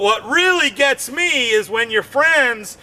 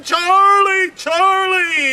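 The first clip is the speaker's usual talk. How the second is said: mock calling out; 8 semitones higher, slower than usual. Man with a leg cramp crying out for Charlie. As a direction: yell for Charlie in pain.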